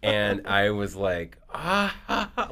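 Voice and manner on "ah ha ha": loud, high, stretched "ah-ha-ha"; exaggerated burst of disbelief; high mock gasping laugh. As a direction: act out an astonished laugh. Acts out his stunned delighted reaction.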